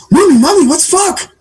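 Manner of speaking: put-on very high, innocent kid question; little boy voice. Little Johnny asking mom about a swear, high child voice. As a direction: squeak it like a curious child.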